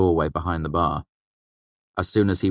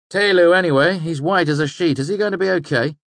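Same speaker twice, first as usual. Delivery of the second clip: put-on higher, lively young trickster voice; young fey man voice; 13 semitones higher, 8 dB louder than usual. A narrator's reading. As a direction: voice Bast higher, quick and sly. Bast voiced by narrator.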